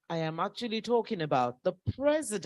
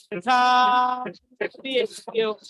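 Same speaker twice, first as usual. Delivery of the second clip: royal salutation; 4 semitones higher, 3 dB louder, much slower than usual. Real, not acted. Emcee hails the king.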